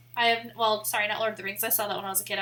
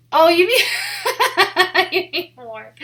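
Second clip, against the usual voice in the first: laughing, drawn-out and loud; oh, you mean, dragged out laughing; 5 semitones higher, 11 dB louder, much slower than usual. Real, not acted. Joking realization, laughing.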